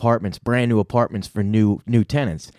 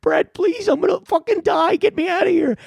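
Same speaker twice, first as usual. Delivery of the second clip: very high panicked squeal; hammed-up pleading for rescue, way up high; retold screaming. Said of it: "And he's screaming".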